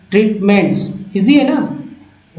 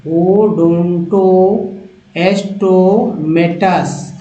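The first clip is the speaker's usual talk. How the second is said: ओडोंटोस्टोमेटस read slowly, part by part; slow down on ओडोंटोस्टोमेटस for learners; teacher demonstrating ओडोंटोस्टोमेटस; slow demonstration; much slower than usual. Shown as a demonstration. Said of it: "यहाँ से देखिए समझिए इसको".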